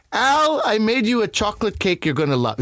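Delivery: loving friend's mother. Retold or imitated. Friend's loving mother offering him cake, high doting call. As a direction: sing out Al like a doting, gregarious mom. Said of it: "it would be like".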